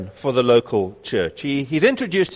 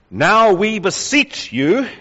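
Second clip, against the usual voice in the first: slow, raised, drawn-out entreaty; urgent, personal plea; 6 semitones higher, 4 dB louder, slower than usual. Shown as a demonstration. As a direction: plead with them, higher and stretched out. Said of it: "it sounds a whole lot more urgent".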